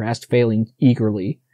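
ordinary delivery, nothing marked

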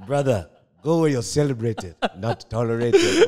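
a lightly hammed-up proverb voice; wise elder reciting a proverb. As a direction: recite it like a village elder's saying. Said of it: "there's an African proverb".

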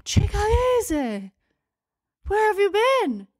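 high, bright voicing of the old shoe seller; imitating the shopkeeper. Shopkeeper greeting her warmly.

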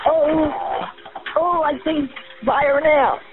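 real terror at seeing fire; panicked fear. Real, not acted. Sees fire in the house.

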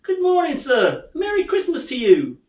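high, hearty passer-by hello; good-humoured greeting. Acted. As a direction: call out a cheery holiday greeting. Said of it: "three or four good-humoured fellows said".

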